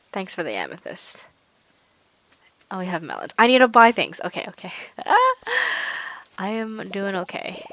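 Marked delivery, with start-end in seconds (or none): 3.4-4.2 excited outburst. Real, not acted. Excited about melons at shop. 4.8-6.3 shrill giggle far higher and louder; high squealing laugh. Real, not acted. Giddy laugh at the shop.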